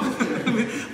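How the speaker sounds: high, loud burst of laughter; real laugh. Real, not acted. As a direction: laugh at the mock prayer. Laughs at own mockery. (tawa lepas yang keras)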